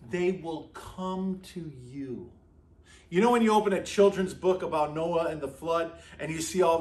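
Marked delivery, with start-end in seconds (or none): 0.1-2.3 slow, soft and hushed; hushed awe. Acted. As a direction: let the verse sink in quietly. Repeating the verse softly.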